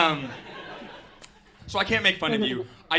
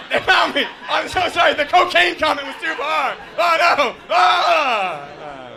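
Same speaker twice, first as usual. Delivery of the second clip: loud, higher hammed-up panic with laughs; mock panic; 8 semitones higher, 7 dB louder than usual. Comic reacting to a siren in her set, mock panicked shouting. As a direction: fake alarm at the siren, shouting.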